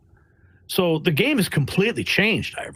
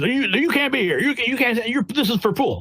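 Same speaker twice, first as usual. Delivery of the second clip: mock officious press guys; 7 semitones higher than usual. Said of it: "these press guys".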